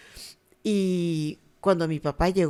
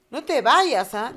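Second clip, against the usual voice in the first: higher and louder plea; imitating pleading child; 4 semitones higher, 6 dB louder, slower than usual. Little girl begging her mother to stay, higher and louder. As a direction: beg mom to stay.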